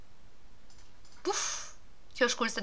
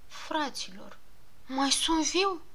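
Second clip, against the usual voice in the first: much softer, barely there; weak and dazed; 5 semitones higher, 7 dB quieter, much slower than usual. Acted. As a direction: ask faintly, just coming to. Waking up after the crash.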